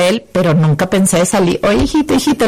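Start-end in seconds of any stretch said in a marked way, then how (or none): 1.6-2.5 retold searching call, pitched up; calling for her son. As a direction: call out lightly, searching for your child. Mother calling out for missing son.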